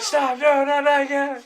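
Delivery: high, loud cry of dread; panicked pleading. Acted. Acting out panic at buzzing.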